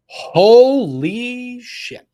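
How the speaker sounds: high, loud, drawn-out disbelief; stunned exclamation. Real, not acted. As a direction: gasp it out in shock. Reacting to huge daily interest.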